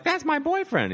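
put-on squeaky high girlish voice; girlfriend voice. Girlfriend in the audience telling the comic, in a high girlish voice. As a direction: pitch way up like an excited young woman. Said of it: "a girlfriend was like".

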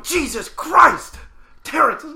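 high, loud, drawn-out exclamation; exasperated outburst. Acted. Scolding friend for assuming the worst, exasperated outburst. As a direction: cry out in exasperation at him.

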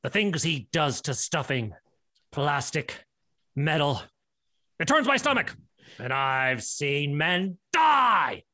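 very low, grim army man; drill sergeant. Acted. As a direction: tell the dark tale like a sergeant. Army man tells grim tale.

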